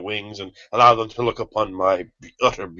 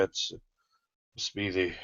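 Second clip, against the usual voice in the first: ordinary delivery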